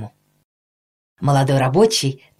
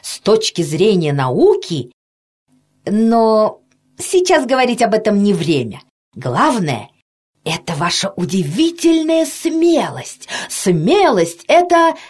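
rising, higher-pitched admiration, breaking off; enthusiastic doctor; 5 semitones higher than usual. A narrator's reading. Doctor praising the worker's courage, increasingly excited. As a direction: build eagerly to an unfinished thought.

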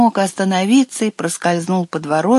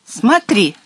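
higher, called-out challenge; defiant cry; 4 semitones higher, 3 dB louder than usual. A narrator's reading. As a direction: cry it out as a dare. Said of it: "крикнул маленький водяной".

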